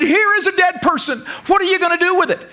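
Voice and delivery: crowd challenging. Retold or imitated. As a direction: dare him to do something. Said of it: "They said".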